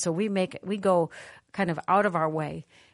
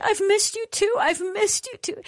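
wailing cry; 4 dB louder than usual. Retold or imitated. Retelling the girl sobbing to God on the floor. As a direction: wail it out, voice breaking. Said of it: "wailing and weeping and crying".

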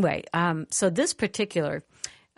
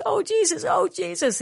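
high, loud, breaking cry of oh Jesus; anguished cry; 13 semitones higher, 5 dB louder than usual. Retold or imitated. Retelling a weeping girl crying out to Jesus. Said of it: "And she says".

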